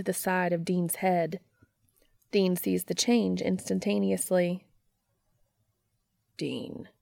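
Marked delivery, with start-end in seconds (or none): 6.4-6.9 low, soft, grave address; serious. A narrator's reading. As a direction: say the name gravely and quietly, pitched low. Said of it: "Cass says seriously".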